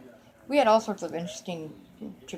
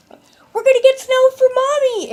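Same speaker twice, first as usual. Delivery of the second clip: put-on high, loud, gleeful kid shout; excited little girls; 10 dB louder than usual. Little girls telling their dad about snow for Mommy, excited shouting. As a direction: holler it like a child bursting with news.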